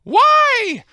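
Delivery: loud, high, drawn-out anguished cry; hammed-up scream of betrayal; retold scream. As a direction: wail it at the top of your voice. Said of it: "He just screamed".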